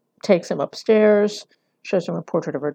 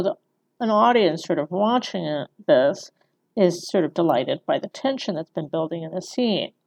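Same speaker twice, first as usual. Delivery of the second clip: ordinary talk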